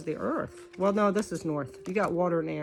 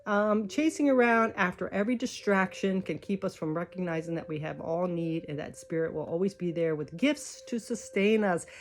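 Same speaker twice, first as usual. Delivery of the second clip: ordinary delivery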